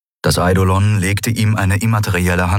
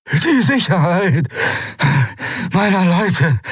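high, slow croak; croaking, out-of-breath pirate; 12 semitones higher than usual. Acted. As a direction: gasp it out for air. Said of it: "krächzte der Pirat".

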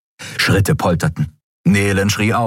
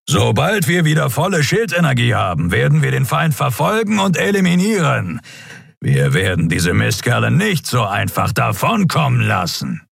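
higher, grim captain's vow; determined captain; 7 semitones higher than usual. A narrator's reading. Captain turning to his crew, vowing pursuit. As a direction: vow to hunt them down.

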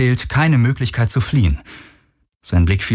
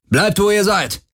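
viel höher und langsam gebrüllt; 11 semitones higher than usual. A narrator's reading. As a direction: den Befehl hinausbrüllen. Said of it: "brüllte einer von ihnen". (ein Syndolon-Soldat brüllt die Crew an, stehen zu bleiben)

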